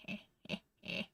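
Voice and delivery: imitating the old man's quiet chuckle; mock creepy old man chuckle, barely there; whispered old man chuckle. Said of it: "Just to be like".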